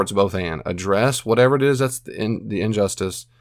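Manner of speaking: ordinary talk, nothing marked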